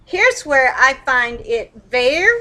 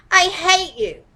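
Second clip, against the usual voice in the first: venting anger; 6 semitones higher than usual. Shown as a demonstration. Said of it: "it feels good to say it".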